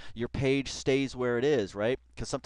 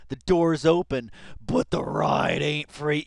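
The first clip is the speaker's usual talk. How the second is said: slow, louder sleazy delivery; sleazy reading; 2 semitones higher, 8 dB louder, slower than usual. Retold or imitated. Viewer mocking creepy lyrics, slow sleazy voice. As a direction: lay it on like a creep.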